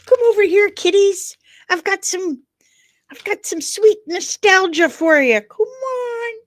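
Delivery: high, loud coaxing wheedle; hammed-up high-pitched enticer; luring kiddies with treats. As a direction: wheedle them over with sweets, squealing at the end. Luring with bag of nostalgia.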